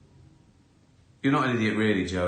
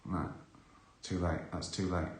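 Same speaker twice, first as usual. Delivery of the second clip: dismissive refusal; 6 semitones lower, 9 dB quieter than usual. Retold or imitated. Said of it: "I go".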